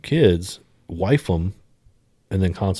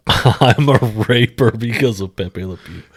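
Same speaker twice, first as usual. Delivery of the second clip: louder, breaking out of a laugh; 3 semitones higher, 7 dB louder than usual. Real, not acted. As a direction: say it through barely held laughter. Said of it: "I don't mean to laugh".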